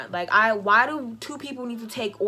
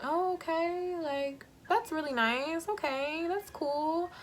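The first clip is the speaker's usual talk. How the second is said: fake polite brush-off; 5 semitones higher, 7 dB quieter, slower than usual. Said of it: "and I'm like".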